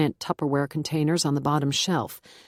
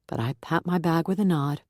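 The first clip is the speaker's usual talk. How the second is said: ordinary delivery, nothing marked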